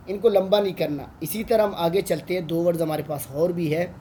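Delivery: usual speaking voice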